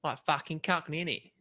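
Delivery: put-on London Cockney; Cockney accent. Shown as a demonstration. Asked by co-host for an accent, Cockney accent. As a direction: give it a broad Cockney twang. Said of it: "Cockney accent?".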